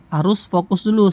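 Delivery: usual speaking voice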